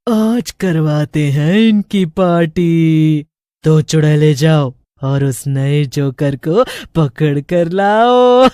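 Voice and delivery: low, slow sinister witch voice. A narrator's reading. Witch ordering her coven to seize the new fool. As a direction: gloat and give orders like a witch queen. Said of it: "चुड़ैल बोलती है".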